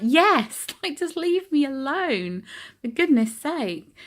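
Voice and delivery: high, loud, laughing exasperation, slowing at the end; exasperated laugh. Retold or imitated. Refusing help again, laughing and exasperated.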